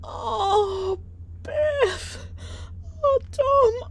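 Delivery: re-enacted happy crying, very high pitched; tearful, overjoyed. Mum hearing her daughter is moving near, high tearful wail. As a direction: wail it high, as if bursting into tears. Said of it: "I just started crying my eyes out".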